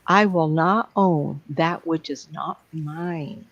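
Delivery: slow, measured personal creed; solemn affirmation. Shown as a demonstration. Teacher modelling her saying for the group, solemn affirmation. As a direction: draw the words out with firm deliberation.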